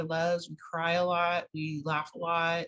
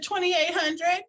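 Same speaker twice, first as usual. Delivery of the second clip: excited reply; 10 semitones higher, 6 dB louder, slower than usual. Retold or imitated. Telling boss the startup cost.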